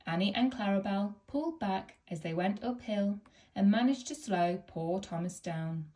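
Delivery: ordinary delivery, nothing marked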